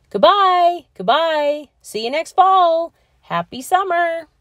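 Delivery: high, loud cheerful calls; calling goodbye. Acted. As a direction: shout goodbyes down the hall. Kids calling to friends.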